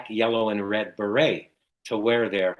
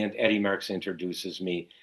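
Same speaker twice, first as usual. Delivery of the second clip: ordinary delivery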